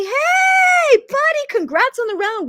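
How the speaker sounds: high, loud cheery call out to a buddy; friendly shout. Retold or imitated. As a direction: hail them like an old friend. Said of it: "hear back and say".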